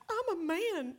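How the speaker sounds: high, weepy imitation of husband; put-on tearful voice; mock weeping. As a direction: squeak it out as if crying. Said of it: "he came out of the bedroom weeping".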